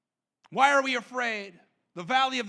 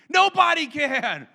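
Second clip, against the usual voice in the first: higher, louder, triumphant declaration; bold proclamation; 5 semitones higher, 5 dB louder than usual. Real, not acted. Preacher declaring to the church, loud and triumphant. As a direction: proclaim it with rising conviction. Preacher answering rhetorical question.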